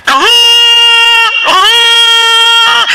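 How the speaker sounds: long loud high blaring cry like a trumpet; imitated trumpet blast. (malakas at mataas na sigaw na parang trumpeta)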